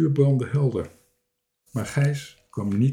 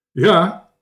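loud, higher, brief answer; calling out; 6 semitones higher, 7 dB louder than usual. A narrator's reading. Little captain answering the stranger from giraffe, calling. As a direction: call it down clearly. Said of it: "riep de kleine kapitein".

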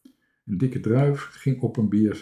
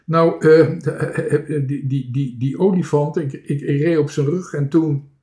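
halting, higher, repeating words; stuttering; 5 semitones higher, 5 dB louder than usual. A narrator's reading. Toontje explains about the elephant, stuttering. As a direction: stumble and stammer through the explanation. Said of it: "Bange Toontje begon te stotteren".

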